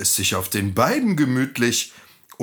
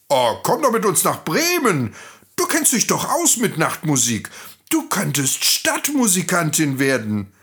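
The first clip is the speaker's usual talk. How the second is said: higher, lively donkey voice; encouraging donkey; 8 semitones higher than usual. Acted. Donkey invites the cat to Bremen, encouraging. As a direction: coax eagerly with a raised pitch.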